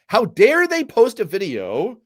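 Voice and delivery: slow mock fury; mock outraged explosion. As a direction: act out the ranter's scandalized outburst. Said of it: "a verbal explosion".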